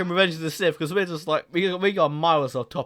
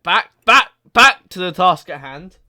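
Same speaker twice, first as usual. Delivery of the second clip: loud, higher, repeated call over chatter; raised voice, cutting through; insistent calling to order; 3 semitones higher, 9 dB louder than usual. Real, not acted. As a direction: break in and drag everyone back on topic. Calling the group back to topic.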